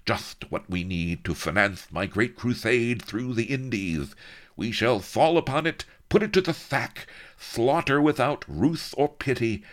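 high, loud gloating viceroy voice; high, bloodthirsty gloating; villainous boast. Acted. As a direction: gloat over the slaughter.

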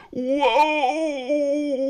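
long, loud high wail of grief; theatrical keening cry; howling. A narrator's reading. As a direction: howl it out in hammed-up mourning. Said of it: "I howled".

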